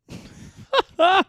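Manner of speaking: loud high cackle at the idea; burst of laughter. Real, not acted. Laughing at his own pitch, loud high burst.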